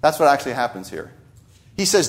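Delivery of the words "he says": usual speaking voice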